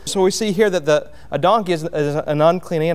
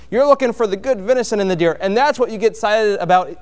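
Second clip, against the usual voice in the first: ordinary talk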